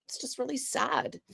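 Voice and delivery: soft, breaking on the edge of tears; quietly choked up; near tears. Real, not acted. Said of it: "I'm gonna cry just thinking about it".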